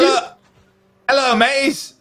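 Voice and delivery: put-on high mixed UK, Australian and pirate accent, said through a laugh; a jokey pirate hello with a mashed-up British-Australian accent; mock pirate-ish accent. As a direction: ham up a jumbled UK-Australian pirate greeting. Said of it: "a combination of, like, a U K accent, an Australia".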